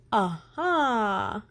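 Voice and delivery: bright discovering aha; light up with the answer; aha realization. Acted.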